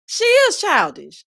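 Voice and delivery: high, loud and slow, leaning on is; emphatic agreement. Real, not acted. Agreeing with a viewer comment, slow emphatic high voice. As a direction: drag it out to make the point.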